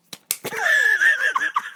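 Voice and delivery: very high squealing laugh; helpless laughter. Real, not acted. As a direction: dissolve into a high wheezy laugh. Cracks up at own story.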